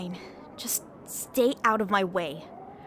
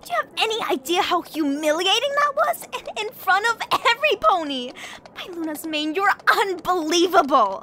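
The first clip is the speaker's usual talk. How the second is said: indignant scolding; 6 semitones higher, 4 dB louder than usual. Acted. Princess scolds guard angrily.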